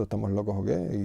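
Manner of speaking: ordinary delivery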